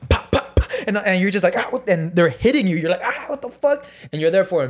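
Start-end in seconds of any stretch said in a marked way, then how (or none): 0.1-0.7 voiceless, percussive popping noises; paintball gun pops. Retold or imitated. Showing paintballs pelting you, gun pops. Imitating paintball guns firing. 1.6-1.9 high-pitched, startled yelp; pained yelp. Retold or imitated. Pelted by paintball guns, pained yelp. Reenacting being hit by paintballs. 3.0-3.9 high, squeaky mock protest; pained disbelief. Being hit by paintballs, pained disbelief.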